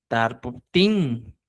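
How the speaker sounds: "Tar pu tin" slow and split into syllables; slow teacher drill. Shown as a demonstration. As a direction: break the word into beats. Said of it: "el ipti".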